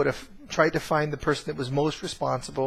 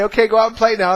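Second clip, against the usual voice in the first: higher, louder cheerful send-off; calling kids out; 8 semitones higher, 10 dB louder, faster than usual. Retold or imitated. Parents telling the boys to go outside, higher louder call.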